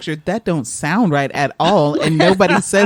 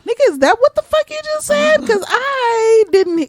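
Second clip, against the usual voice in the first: much higher, mock incredulous squeal; incredulous retort. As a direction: act stunned that anyone understood. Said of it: "and I was like".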